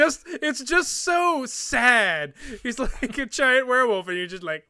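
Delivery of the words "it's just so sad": drawn-out, higher, mock-heartbroken lilt; mock pity. Player pitying the boss he shot, mock-sad. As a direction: stretch out the sad part in pretend sorrow.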